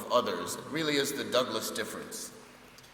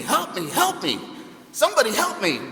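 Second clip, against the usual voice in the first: high, loud frantic calls for help; panicked screaming; 9 semitones higher, 6 dB louder than usual. Retold or imitated. Monkey screaming at the crawling lion, terrified. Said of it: "he's just screaming".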